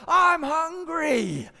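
loud, high, drawn-out yell; shouting child. Retold or imitated. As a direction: imitate a kid yelling over a parent. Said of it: "started shouting at me".

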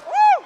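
very high, loud celebratory whoop. Real, not acted. Runner finishes, leader lets out a loud whoop. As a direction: let out a victory whoop.

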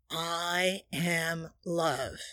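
very slow, soft I am love; said slowly. Shown as a demonstration. Showing listeners how to say it when feeling down, very slowly. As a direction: stretch every word out with a breath. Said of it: "say that slowly".

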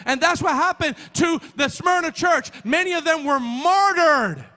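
loud, high-pitched sermon delivery; full-force preaching. Real, not acted. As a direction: preach it at the top of your register, stretching key words.

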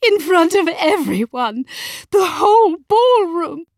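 high, loud, tearful protest; shrill-pitched outraged wife, near tears; trembling outrage. A narrator's reading. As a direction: cry it out in scandalised fury. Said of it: "voice was trembling with outrage".

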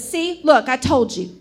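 retold crow of vindication; triumphant. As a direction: imitate a grandpa proudly proving his point. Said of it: "my papa all triumph".

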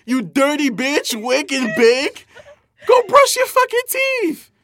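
shrill, loud outraged screech; disgusted yelling. Real, not acted. Disgusted at friends who smoke before brushing, yelling shrilly. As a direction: scream at them in disgust. Disgusted outburst over wake and bake.

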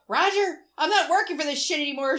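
high, loud fed-up yell; fed-up shout. Retold or imitated. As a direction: shout it at the manager. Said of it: "she says".

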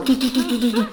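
high staccato "tu" keystrokes; imitated typewriter pounding. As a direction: hammer the syllables out high. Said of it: "Do toho pořád mlátila". (napodobené mlácení do psacího stroje)

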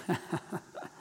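a short breathy nervous laugh; awkward laugh. Real, not acted. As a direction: let out an uneasy little laugh. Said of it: "That's my awkward laugh".

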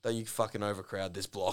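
hushed, low-pitched aside; low mock mutter. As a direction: imitate a passer-by grumbling under his breath. Acted quote of men walking past.

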